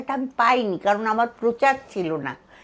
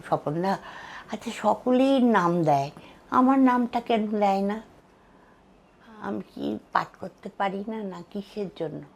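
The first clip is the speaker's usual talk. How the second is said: hurt question, sinking softer; understated hurt, fading almost to nothing; retold tearful complaint; 7 dB quieter than usual. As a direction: quietly retell a wounded appeal. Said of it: "কেঁদে বলেছিলাম".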